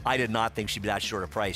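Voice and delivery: normal talking voice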